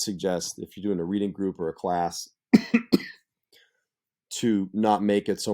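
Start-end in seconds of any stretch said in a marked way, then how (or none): 2.5-3.1 cough. Real, not acted. Coughs mid-sentence.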